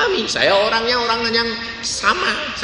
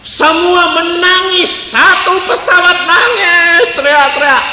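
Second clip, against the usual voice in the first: dramatic; 10 semitones higher, 4 dB louder, slower than usual. A narrator's reading. Describing panicked crying passengers.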